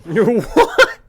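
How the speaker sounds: high-pitched, loud mock-shocked "what". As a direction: squawk it in exaggerated disbelief. Retold shock at drugs in car.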